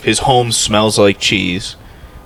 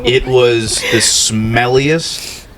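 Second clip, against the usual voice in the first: drawn-out revolted description; slow disgusted emphasis; 3 semitones higher, much slower than usual. Real, not acted. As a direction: stretch each word out in disgust. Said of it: "disgusted emphasis on bad cheese".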